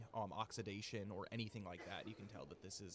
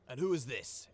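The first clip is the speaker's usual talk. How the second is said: stern guard voice; 3 semitones higher, 7 dB louder than usual. Acted. Guard captain questions the party.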